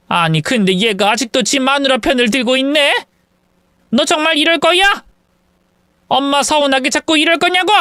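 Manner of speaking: indignant mother lecturing her grown son; scolding mother-in-law. Acted. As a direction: berate the son, warning him of tears later.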